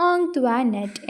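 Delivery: ഓങ് ത്വാ നെറ്റ് said as ong-twa-net; ഓങ് ത്വാ നെറ്റ് with "twa" in the middle, not "joy"; taught French-style pronunciation. Shown as a demonstration. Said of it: "എങ്ങനെയാ pronounce ചെയ്യേണ്ടത്".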